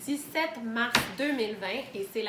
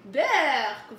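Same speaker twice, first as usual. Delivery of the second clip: loud storybook "yuck"; disgusted exclamation; 7 dB louder than usual. A narrator's reading. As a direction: blurt it out in mock disgust. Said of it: "et s'exclama". (exclamation de dégoût jouée)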